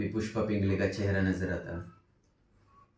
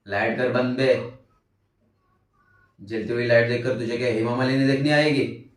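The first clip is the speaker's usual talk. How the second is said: louder, mocking scolding jab; rude roommate; 5 dB louder than usual. Roommate snapping at narrator over the light left on, rude and mocking. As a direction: voice an irritated roommate, forceful and taunting.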